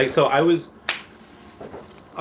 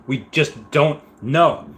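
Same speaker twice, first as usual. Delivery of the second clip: drawn out; 3 semitones higher, 3 dB louder, much slower than usual. Real, not acted. Marvelling at unsolved mystery.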